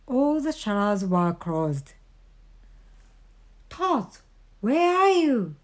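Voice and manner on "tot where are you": high, searching call; storybook Frog calling. A narrator's reading. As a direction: call into the house for a friend. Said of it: "called the Frog".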